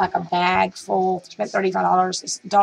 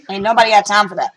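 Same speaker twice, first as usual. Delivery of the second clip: louder and fast, punching got; meme catchphrase; 7 dB louder, faster than usual. Retold or imitated. Brushing off the heart attack warning with a loud fast meme line. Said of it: "And I'm like".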